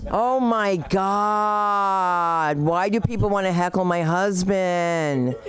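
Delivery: drawn-out, incredulous complaint; mock exasperation. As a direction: stretch the words out in theatrical dismay.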